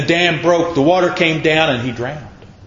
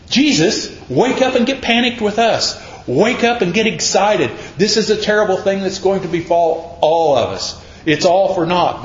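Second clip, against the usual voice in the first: higher, urgent pleading paraphrase; mock panic; 6 semitones higher than usual. Disciples begging Jesus to wake up, higher mock panic. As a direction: plead as if the boat is going down.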